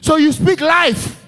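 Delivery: loud, slow, high exhortation, each word driven; fiery preaching. Real, not acted. Preacher urging the church to speak life, fiery and loud. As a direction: preach it with full force.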